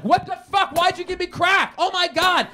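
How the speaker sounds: high, loud panicked outburst; acted-out freak-out at full volume. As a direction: yell it in a high-pitched panic. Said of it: "he is freaking out too".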